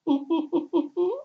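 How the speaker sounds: high, put-on goofy giggle; mock chuckle. Mocking the "just friendly" crowd, high fake giggle.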